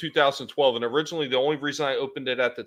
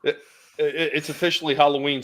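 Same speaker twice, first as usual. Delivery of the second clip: ordinary talk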